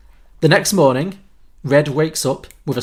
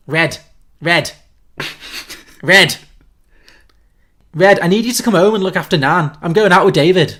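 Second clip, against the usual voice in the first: Scouse mum calling for her daughter; louder, higher Liverpool accent, ordering her home; 4 semitones higher, 6 dB louder than usual. Acted. Mum phones Red to come home and look after Nan, Scouse accent. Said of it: "so the note is Scouse accent".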